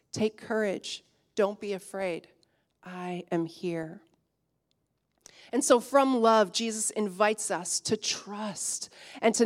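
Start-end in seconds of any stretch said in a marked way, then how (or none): 0.1-4.0 tender. Acted. Jesus' reassurance voiced.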